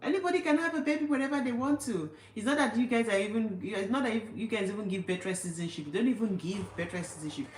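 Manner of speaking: high-pitched, exasperated comeback; indignant retort. Retold or imitated. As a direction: argue back at the critics, voice pitched right up. Acted retort to her critics.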